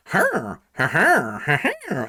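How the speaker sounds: imitating a Minecraft villager's hmm grunts. Waiter Jeb talking like a villager, mock grunts. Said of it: "he's just like".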